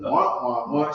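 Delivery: a hint of cartoon wah-wah talk; Charlie Brown cartoon wah-wah. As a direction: lightly imitate cartoon grown-up babble. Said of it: "sounded like the Charlie Brown cartoon".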